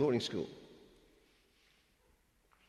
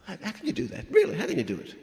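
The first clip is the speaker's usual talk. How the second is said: 1 mock incredulous, laughing; 8 semitones higher, 4 dB louder, faster than usual. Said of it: "folks said to us".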